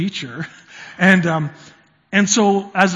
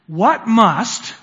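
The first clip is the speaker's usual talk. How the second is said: MUST hit louder and higher; stress on MUST; 4 semitones higher, 4 dB louder than usual. Shown as a demonstration. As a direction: lean hard on the word "must". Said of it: "emphasis on must".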